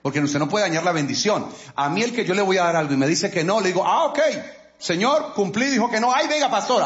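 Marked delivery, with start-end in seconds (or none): 3.8-6.8 voz de pastor burlona, más aguda; mock pastor. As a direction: imitando a un pastor que se resigna, alto y exagerado. (pastor fingido, voz aguda y teatral)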